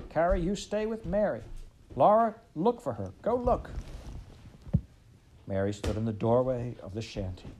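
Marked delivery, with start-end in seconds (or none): 0.1-3.7 loud, high orders over the shoulder; calling back. A narrator's reading. Ma calling orders back to her daughters, loud and high. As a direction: call back urgent orders while running. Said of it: "calling back".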